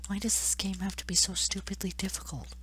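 soft, high, whispery whine; despairing whine. Real, not acted. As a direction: complain in a tiny high voice. Defeated complaint after failing again.

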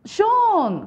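"shown" stretched long, high and loud; drawn-out word demo. Shown as a demonstration. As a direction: hold out "shown" as one long loud syllable. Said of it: "should 빼고요".

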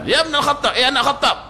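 mock angry Bedouin addressing Umar; angry call. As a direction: snap his father's name at him. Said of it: "Dia marah".

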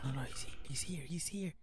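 hushed urgent whisper. Real, not acted. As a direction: breathe the warning softly. Hiding, monster nearby.

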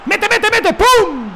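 high, loud urging into a shouted bang; excited shouting. Real, not acted. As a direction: yell it at the screen as the shot goes in. Urge el remate en el juego. (gritando emocionado)

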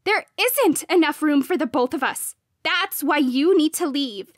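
loud, high, angry demand; heated shouting in an argument; screamed. A narrator's reading. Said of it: "she screamed".

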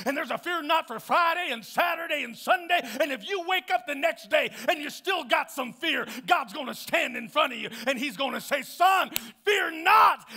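loud, rising preacher's cry; full-force preaching. Acted. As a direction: preach it out, building and pushing hard. Preaching climax.

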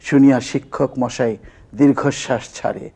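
measured, rhythmic verse recital; comic poem recitation. Acted. Former student reciting their teasing poem about a campus girl, comic recitation. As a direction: recite it like comic rhyming verse, leaning on the beats.

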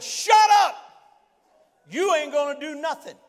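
high shout easing into firm dismissal; retold defiant rebuke. Preacher retells silencing the devil, loud defiant rebuke.

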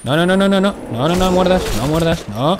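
high, loud frantic pleading at a monster; begging it not to bite, in real panic; panicked no no. Real, not acted. Player screaming at a biting monster, panicked and loud.

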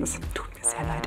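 soft whisper, like a secret confession; mock whispered apology. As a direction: whisper it as a playful aside to the teachers. Confessing lie to her teachers. (geflüsterte Entschuldigung an die Lehrer, verschmitzt)

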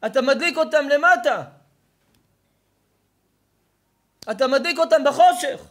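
loud, high-pitched sermon delivery; fiery preaching. Acted. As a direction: preach it hard to the crowd. Preaching at full force.

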